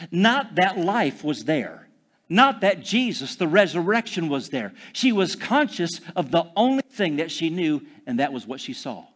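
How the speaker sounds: normal talking voice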